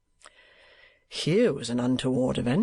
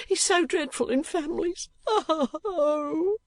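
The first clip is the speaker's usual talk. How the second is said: much higher wailing wife's voice; tearful cry; slower than usual. A narrator's reading. Wife cries out to defend her husband from the uncle. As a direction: wail it in distress. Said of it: "cried Missus Kenwigs".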